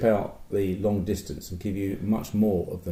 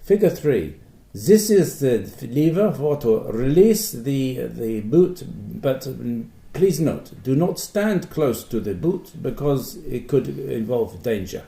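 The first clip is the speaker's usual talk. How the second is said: mock German accent; 3 semitones higher, slower than usual. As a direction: read the manual in a put-on German accent. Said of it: "I have to almost do it in the German accent".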